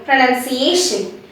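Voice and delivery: "Pronunciation" with unrounded pruh, not pro; model pronunciation. Shown as a demonstration. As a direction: clear teacher model of "Pronunciation". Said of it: "In pronunciation, we have P R O N U N C I".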